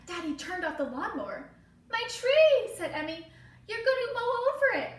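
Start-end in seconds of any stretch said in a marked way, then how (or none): 1.9-2.8 loud, high panic; alarmed cry. Acted. Emmy crying out to Daddy about her tree. 3.7-4.9 loud, high panic; alarmed cry. Acted. Emmy crying out to Daddy about her tree.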